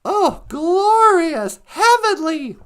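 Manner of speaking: hammed-up heavenly bliss; mock rapture. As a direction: gush about it slowly. Raves about heaven.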